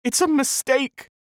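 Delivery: high, disbelieving cry; desperate cry. A narrator's reading. Alice denying her baby is dead, high desperate cry. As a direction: refuse the news, voice cracking high. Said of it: "she cried".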